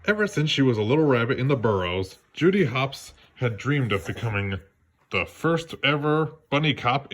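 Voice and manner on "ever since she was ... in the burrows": ordinary delivery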